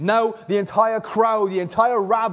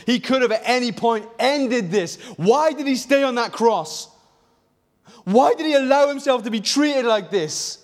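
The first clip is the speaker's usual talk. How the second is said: impassioned preaching; 5 semitones higher than usual. Real, not acted. Rising preaching climax.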